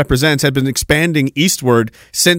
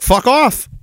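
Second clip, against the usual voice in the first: higher, curt brush-off; blunt mock dismissal; 9 semitones higher than usual. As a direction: toss it off with high mock contempt. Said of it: "And they're like".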